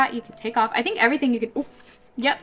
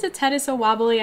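ordinary delivery

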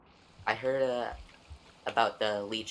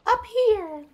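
squeaky shout; 9 dB louder than usual. Pretend. Sudden squeaky cry in game.

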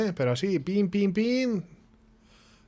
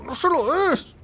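drawn-out high plea; high slow wail; 13 semitones higher, slower than usual. Real, not acted. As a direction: stretch it out high and pleading. Third plea higher slower.

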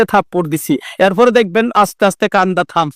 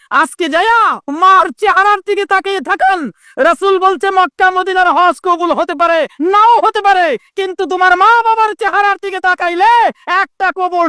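high-pitched sustained exhortation; impassioned preaching; 11 semitones higher than usual. Acted. Preacher urging listeners to look at their parents, high fervent exhortation. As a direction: drive the point home on a raised pitch.

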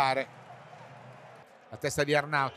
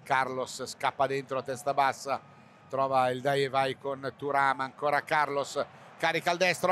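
normal talking voice

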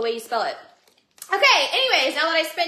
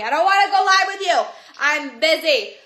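exasperated refusal; 7 dB louder than usual. Real, not acted. Streamer refusing requests to go live together, exasperated. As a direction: push it out loud, fed up with being asked.

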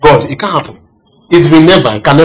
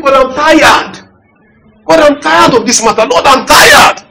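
higher, pleading cry to God; plaintive prayer; 8 semitones higher, slower than usual. Retold or imitated. Pastor re-enacting his weary prayer to God. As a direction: beg God like a worn-out man.

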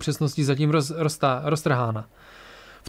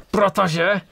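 higher, strained voice; pained, forced out; 5 semitones higher, 3 dB louder than usual. A narrator's reading. Bastien hurt, answering why he believes, straining painfully. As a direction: squeeze the word out through pain. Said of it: "vysoukal ze sebe bolestně Bastien".

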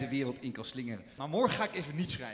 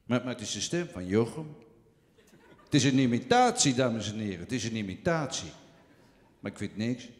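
lage, trage stem van een oudere komiek; Toon Hermans imitatie; 4 semitones lower than usual. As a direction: zak diep en traag weg als een oude cabaretier. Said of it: "ik denk ongeveer zoiets als dit". (trage, lage imitatie van een oude komiek)

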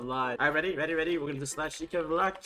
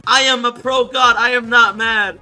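mad; 4 semitones higher, 8 dB louder than usual. Acted. Reading out a rival denying he's mad, mock anger. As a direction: insist loudly, word by word. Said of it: "is somebody mad".

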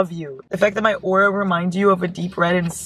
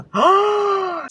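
sharp high surprised gasp. Real, not acted. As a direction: gasp at the twist. Reads the book blurb.

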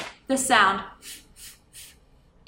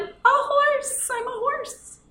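horse. Acted. Animal answer in role.

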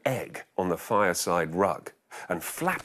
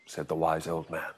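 plain reading voice

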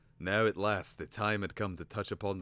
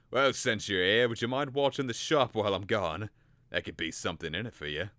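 louder, wheedling trader voice; gruff shopkeeper; 3 semitones higher, 5 dB louder than usual. Acted. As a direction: ask a favour gruffly. Flab Sweat voiced in story.

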